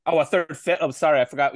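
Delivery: ordinary talk, nothing marked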